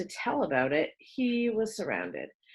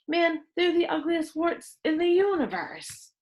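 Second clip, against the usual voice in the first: put-on higher, gleeful jeering kid; mocking exaggeration; 10 semitones higher than usual. A narrator's reading. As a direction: outdo the others with a sneer. Boy topping the insults.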